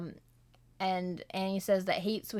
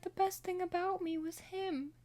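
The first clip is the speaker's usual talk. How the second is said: high, soft grieving line; mournful quote; 11 semitones higher, 4 dB quieter than usual. Retold or imitated. Smoke mourning his dead brother, softly heartbroken.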